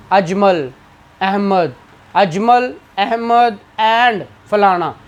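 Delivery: short pause after "Ajmal" and after "Ahmad"; short breath pauses. Shown as a demonstration. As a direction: read "Ajmal, Ahmad" name by name, a small breath between. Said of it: "पढ़ते हुए सांस लेते हैं". ("अजमल" और "अहमद" के बाद छोटा सा वक्फ़ा)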